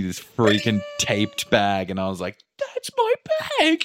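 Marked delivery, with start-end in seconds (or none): 2.6-3.8 squealing mock relief. Spotting his taped bag.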